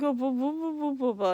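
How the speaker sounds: imitating numb, garbled speech; numb-mouth mumble. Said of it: "all I sounded like".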